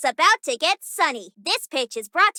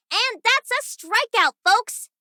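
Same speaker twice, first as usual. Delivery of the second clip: bright, raised play-by-play call; announcer call; 5 semitones higher than usual. Acted. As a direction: call it to the crowd. Sportscaster calling the strikeout.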